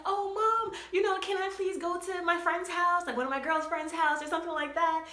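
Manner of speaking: put-on high, sweet pleading child voice; young daughter asking mom to visit a friend, high pleading; pleading kid. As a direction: beg mom in a high little-girl voice. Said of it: "I used to be like".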